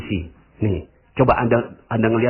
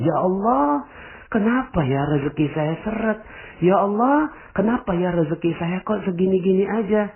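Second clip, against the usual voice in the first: mock whiny prayer; 8 semitones higher than usual. Imagined complaining prayer, whiny.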